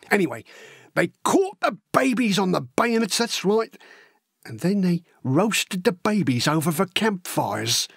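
lively, wide-eyed working-class lad spinning war tales; excitable storytelling with big pitch leaps; eager young Cockney recruit. Acted. Horace telling Elsie gruesome war stories, eager and excitable.

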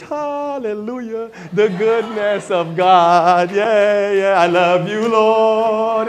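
loud, slow, hammed-up churchgoer praise; mock pious praise. Churchgoer performing devotion at church, hammed-up loud praise. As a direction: overdo the Sunday worship act. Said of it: "And then we come to church".

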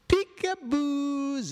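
very high, drawn-out delighted peekaboo; spring the surprise on the little ones; high peekaboo reveal. Acted. Reader revealing the cat to children, squealing high.

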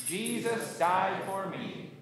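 slow, evenly spaced declaration; congregational recitation. Shown as a demonstration. Pastor leading congregation's profession of faith, slow recitation. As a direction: stretch each word for a group to follow. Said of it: "and say it with me".

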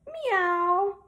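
high, loud cat meow; puppy meows. Acted. Puppy George answering his mother, a high meow. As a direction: meow like a little kitten. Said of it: "George said".

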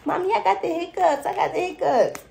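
put-on high, loud child's voice; little child. A child running into mom's room, loud and high. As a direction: run in crying out like a small kid. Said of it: "this could be a child".

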